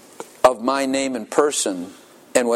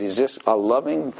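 ordinary talk

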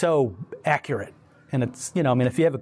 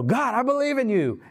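last-minute plea; 10 semitones higher than usual. Acted. Sinner at the very last minute, crying out high to God. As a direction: call out with your pitch leaping up.

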